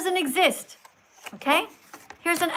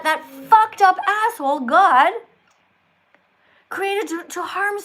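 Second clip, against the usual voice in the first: loud, higher, angry outburst; angry rant; 4 semitones higher, 6 dB louder than usual. Real, not acted. Atheist streamer cursing God, angry and loud. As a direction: spit out the insult at full voice.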